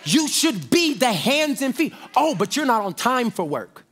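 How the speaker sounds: rising shouted preaching cadence. Acted. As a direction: preach it to the congregation at full force. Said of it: "I'm preaching up here, y'all".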